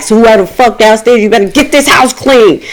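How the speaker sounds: retold holler down the stairs; retold yell. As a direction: order whoever is downstairs, fed up. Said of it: "I had to yell down the steps".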